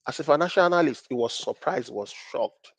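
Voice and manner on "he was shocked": ordinary talk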